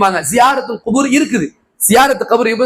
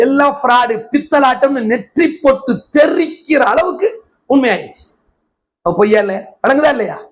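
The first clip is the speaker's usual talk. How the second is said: normal talking voice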